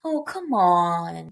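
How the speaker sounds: slow, drawn-out youthful protest; imitated youngster. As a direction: stretch it like a kid complaining. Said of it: "Very young, like, and very".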